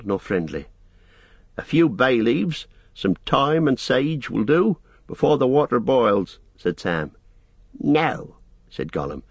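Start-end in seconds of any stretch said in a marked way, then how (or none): 1.6-6.4 higher, lilting request; coaxing request. A narrator's reading. Sam sends Gollum for herbs, raised coaxing voice. 7.8-8.4 high, sulky creature voice; Gollum refusing. A narrator's reading. Gollum refuses Sam's errand, high creature voice.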